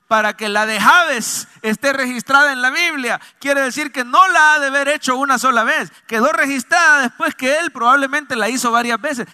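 high-pitched, forceful sermon delivery; full-force preaching. Real, not acted. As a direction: drive it home to the congregation.

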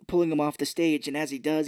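normal talking voice

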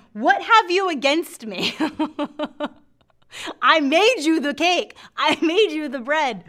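high, loud, exasperated protest; indignant accusing mother. Acted. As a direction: plead your case loudly, half laughing at it. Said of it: "she's over there going".

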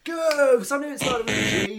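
excited. Real, not acted. Remembering the answer.

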